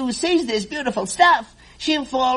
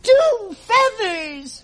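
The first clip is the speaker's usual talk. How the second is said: slow, higher delighted cry; cheering; 6 semitones higher, much slower than usual. Acted. Gertrude cheers over her second feather. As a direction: cheer at the sight of the new feather. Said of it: "she cheered".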